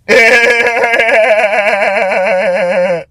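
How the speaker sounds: drawn-out, high rolling laugh; long high laugh. Real, not acted. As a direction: crack up at your own punchline. Laughs at own punchline.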